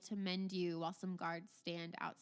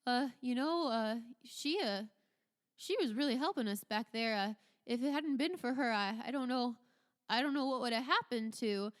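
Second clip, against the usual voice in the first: hesitant guard, louder and pitched up; higher, stumbling, earnest soldier voice; Dwight; 4 semitones higher, 6 dB louder than usual. Acted. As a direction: speak up haltingly in her defence. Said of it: "Dwight speaks up and he says".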